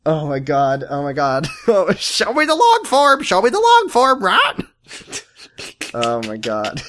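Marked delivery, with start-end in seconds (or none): 1.7-4.5 high, squawking parrot demand; silly high bird squawk; talking bird. Acted. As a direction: repeat it like a trained cockatoo. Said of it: "most birds are like".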